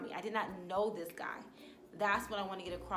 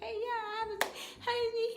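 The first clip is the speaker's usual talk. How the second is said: much higher, bright put-on politeness; fake friendly greeting; 14 semitones higher, 3 dB louder, slower than usual. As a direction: greet him sweetly while faking it. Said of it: "he know that fake".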